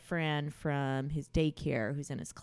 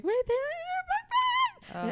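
much higher panicked cry about the bags; a mother retelling her panic at chaotic drop-off, much higher; alarmed; 4 dB louder than usual. Retold or imitated. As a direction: shriek it in disbelief.

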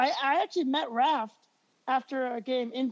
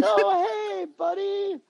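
put-on baby-talk him like a lost kid; talking down to a child; 6 semitones higher, slower than usual.

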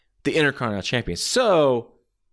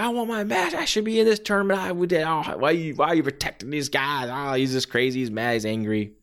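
ranting Terry Funk; 2 semitones higher than usual. Retold or imitated. Wrestler demanding a match, wild rant. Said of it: "And Terry Funk's like".

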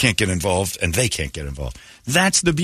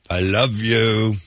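slow love declaration; much slower than usual. Acted.

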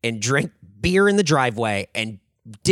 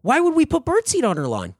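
high, louder mock-innocent protest; feigned bafflement; 14 semitones higher, 5 dB louder than usual. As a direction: act baffled by the very idea. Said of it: "just repeating it back".

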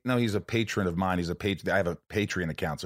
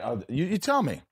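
normal talking voice

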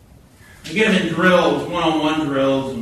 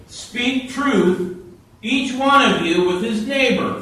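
scripture reading; 6 semitones higher than usual. Acted. Pastor reading Ephesians to the congregation, raised scripture voice. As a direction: read the verses out to the church with weight.

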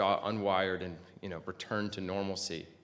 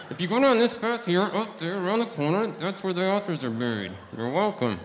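wired jaw, straining to project; through gritted teeth, louder; 10 semitones higher, 7 dB louder than usual. Retold or imitated. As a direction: push it out loud through clenched teeth. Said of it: "because I can't project".

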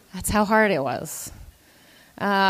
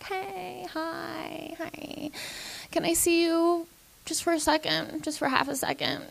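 soft, secretive coaxing, a bit higher; sneaky quiet manager; 7 semitones higher, 9 dB quieter than usual. Retold or imitated. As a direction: lure him in quietly like a secret. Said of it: "She was like".

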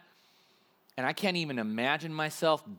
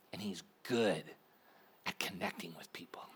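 hushed awe; 9 semitones lower, 9 dB quieter than usual. Real, not acted. Awed aside about Jesus.

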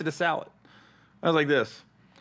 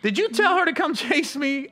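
indignant question; 10 semitones higher than usual. Retold or imitated. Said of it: "I go".

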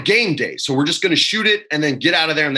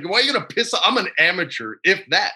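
ordinary talk, nothing marked

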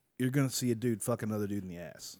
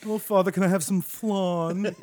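put-on spoiled kid voice; 8 semitones higher, 7 dB louder than usual. Mocking a pampered child asking.

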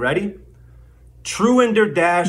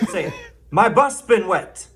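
ordinary delivery

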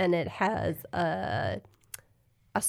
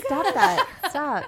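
embarrassed protest; 7 semitones higher, 4 dB louder than usual. Real, not acted. Embarrassed at being teased.

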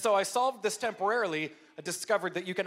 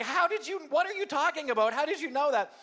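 flustered disbelief; 9 semitones higher, 3 dB louder than usual. Retold or imitated. Flustered reply to the kids.

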